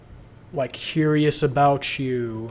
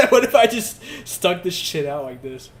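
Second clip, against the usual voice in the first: high giggle, words through laughter; laughing while talking; 5 semitones higher, 6 dB louder than usual. Real, not acted. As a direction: crack yourself up. Joking, laughing at own joke.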